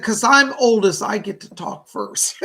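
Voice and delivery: put-on a light, cheeky old-lady brag with a laugh; grandmother's playful boast. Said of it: "She said".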